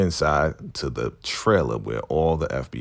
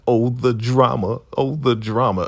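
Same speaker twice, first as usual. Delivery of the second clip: mock melodrama; 6 semitones higher, 3 dB louder than usual. Mocking the woman's anguish.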